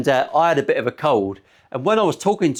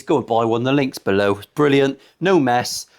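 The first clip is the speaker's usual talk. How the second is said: ordinary talk, nothing marked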